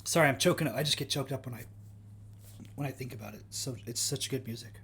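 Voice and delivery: softly pretending to be overcome; mock choked up. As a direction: fake getting choked up, voice dropping away. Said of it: "I'm, I'm being facetious".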